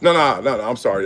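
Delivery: normal talking voice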